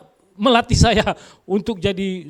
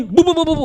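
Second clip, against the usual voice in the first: nagging babble; 8 semitones higher, 4 dB louder, faster than usual. Retold or imitated. Wife nagging back at husband.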